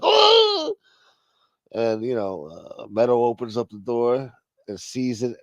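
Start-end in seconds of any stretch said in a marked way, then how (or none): 0.0-0.8 high mock yelp of fright; startled yelp. Kid ducking under the table, mock startled yelp. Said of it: "the fucking kid fucking ducks under the table like".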